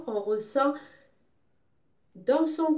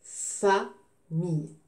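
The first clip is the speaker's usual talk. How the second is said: slow FA, pause, MILLE; teacher repeating "Famille" slowly in pieces; syllable by syllable; 3 semitones lower, much slower than usual. Shown as a demonstration. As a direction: split "Famille" into two separate beats. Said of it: "je découpe le mot".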